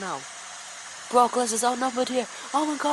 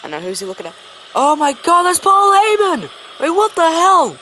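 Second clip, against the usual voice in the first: screaming shock; 10 dB louder than usual. Real, not acted. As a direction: erupt at the surprise attack. Surprise chair attack after match.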